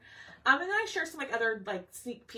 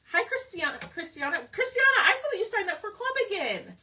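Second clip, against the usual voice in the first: excited, high greeting; 9 semitones higher, 4 dB louder than usual. Real, not acted.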